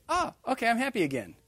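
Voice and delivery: put-on high, loud suddenly happy child voice; sing-song mock delight; mock cheerful kid. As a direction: play the kid who instantly brightens. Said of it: "What does he do?".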